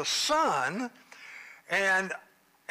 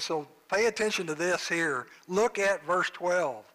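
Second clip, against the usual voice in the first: ordinary talk, nothing marked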